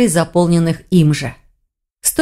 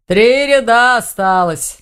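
slow, drawn-out grumble; grumbling orc; 3 semitones higher, slower than usual. A narrator's reading. Orc busy knitting, three rows left, grumbling. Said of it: "проворчал Думон".

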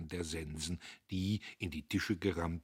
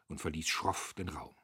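ordinary delivery, nothing marked

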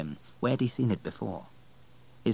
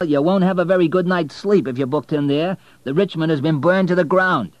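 high, loud, chatty cabbie; cab driver voice; 9 semitones higher, 9 dB louder than usual. Acted. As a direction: voice the talkative driver.

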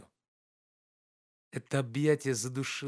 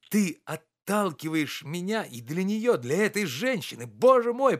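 rising higher and louder to a cry; near shout in pain; 7 semitones higher, 6 dB louder than usual. A narrator's reading. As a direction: cry out as if struck by sudden pain. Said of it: "почти закричал он, как от внезапной боли". (почти крик от внезапной боли)

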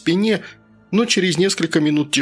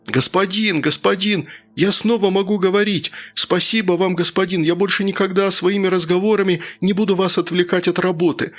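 higher-pitched, gushing plea to the master; a frightened underling begging in a raised voice; pleading worker; 4 semitones higher than usual. Acted. Worker thanks the master for his voice back, higher-pitched pleading.

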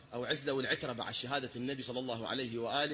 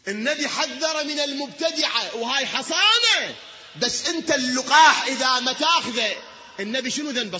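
high, loud, drawn-out pulpit cry; fervent preaching; 10 semitones higher, 9 dB louder than usual. Acted. Preacher warning the congregation about heresy, fervent shout. Preacher rises to full force.

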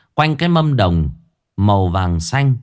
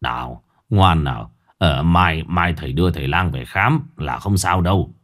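father calming his son; gentle low hush to a child; soothing father; 6 semitones lower than usual. Acted. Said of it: "nhưng vẫn dỗ con".

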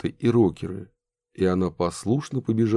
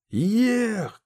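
shouting; 13 semitones higher than usual. Acted. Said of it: "кричали рокеры".

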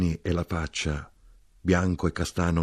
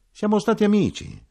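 high-pitched, louder interjection; eager little man; 5 dB louder than usual. A narrator's reading. Small-eyed drinker claiming an old friendship, high eager voice.

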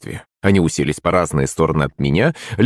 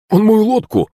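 high-pitched whining servant voice; high, indignant character voice; aggrieved complaint; 10 semitones higher than usual. Acted. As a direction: protest like a wronged tattletale, pitch way up. Servant complaining about his opponent.

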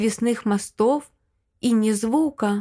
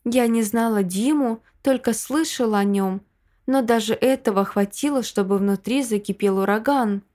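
ordinary delivery, nothing marked

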